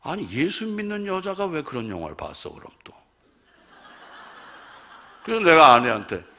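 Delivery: normal talking voice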